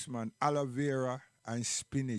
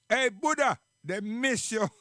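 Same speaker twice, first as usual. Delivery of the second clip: calling out; 6 semitones higher, 6 dB louder than usual. Real, not acted. Calling out a dedication.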